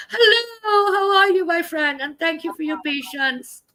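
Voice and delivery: effusive greeting. Real, not acted. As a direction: greet a guest in a high, gushing voice. Greeting guest brought on stage.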